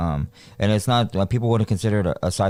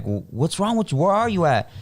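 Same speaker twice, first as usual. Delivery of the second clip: alarmed question; 10 semitones higher than usual. Retold or imitated. Answering panicked phone call.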